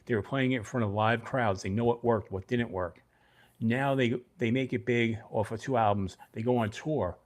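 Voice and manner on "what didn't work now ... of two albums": normal talking voice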